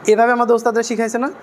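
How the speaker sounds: normal talking voice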